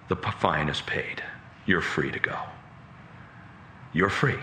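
low, soft judicial release; gentle pardon. Acted. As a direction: pronounce it tenderly, leaving space between phrases. Judge gently releases his son.

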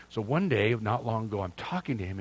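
usual speaking voice

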